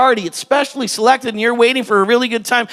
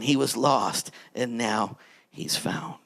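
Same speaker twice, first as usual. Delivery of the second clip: whispered, much softer and lower; hushed tender close; 11 semitones lower, 12 dB quieter, slower than usual. Acted. Father reassuring his son, soft whisper. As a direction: breathe the last words out gently.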